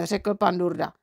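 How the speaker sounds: plain reading voice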